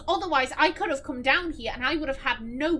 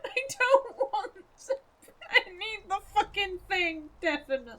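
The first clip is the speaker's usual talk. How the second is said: scared half-laugh, half-cry; whimpering through a laugh; 6 semitones higher than usual. Real, not acted.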